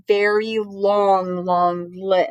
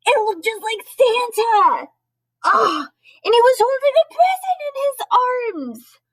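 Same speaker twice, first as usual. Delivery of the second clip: high, breath-catching wonder; excited, pitched-up reveal; amazed storybook reading; 4 dB louder than usual. A narrator's reading. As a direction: gasp and read it wide-eyed with awe.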